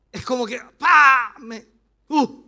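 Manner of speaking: sudden loud pa and uh; impact noise. Retold or imitated. Pastor showing how family trouble hits him, loud blow sounds. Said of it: "A mí me afecta eso".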